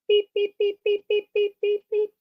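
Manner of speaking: short, very high beeps; high-pitched, evenly repeated vocal beeps; imitated beeping. Shown as a demonstration. Teacher giving a sound to copy in a song, beeping. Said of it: "we make a sound either vocally".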